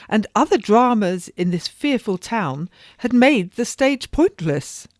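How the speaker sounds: lightly voiced lady recalling a prologue with glee; excited society lady. Lady Paris finishing the quote.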